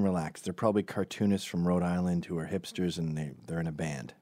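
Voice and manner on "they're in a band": usual speaking voice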